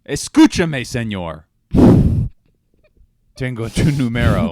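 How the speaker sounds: loud, high, hammed-up Spanish demand; mock confrontation in Spanish. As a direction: belt it out in overblown Spanish. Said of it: "I'd say it in Spanish".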